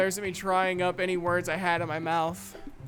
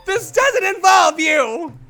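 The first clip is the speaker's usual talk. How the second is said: high, loud, slow yell; furious shout; 7 semitones higher, 12 dB louder, slower than usual. Acted. Quarrelling girls shouting down a peacemaker, furious. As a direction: shout down the interruption. Snaps at Monika interrupting.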